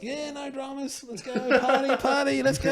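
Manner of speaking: high, stretched-out crowd chant; party cheer. Retold or imitated. Young partygoers egging on an older guest, rowdy cheer. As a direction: imitate revellers whooping for a party. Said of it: "and they were like".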